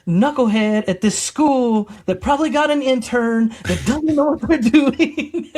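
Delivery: high, loud, scornful rant with a laugh; hammed-up outrage at the school; mock indignant rant. Husband ranting to wife about the grader, mock indignant.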